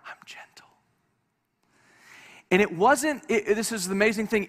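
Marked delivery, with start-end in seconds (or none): 0.1-0.7 soft unvoiced whisper. Shown as a demonstration. Preacher whispering God's word in a tense moment. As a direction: let it come as a hush. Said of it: "I hear this whisper in my heart".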